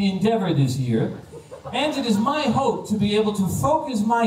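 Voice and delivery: measured, carefully recited mock speech; German exchange student. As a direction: introduce yourself like an over-formal foreign pupil. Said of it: "Wilfried said".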